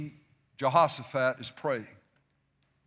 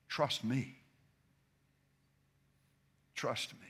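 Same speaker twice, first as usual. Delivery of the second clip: Trust me said much softer, almost under the breath; soft and intimate; 5 semitones lower, 10 dB quieter than usual. Shown as a demonstration. Preacher showing another way to say it, hushed and soft. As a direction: hush it down and let it land gently. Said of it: "And then you can say it this way".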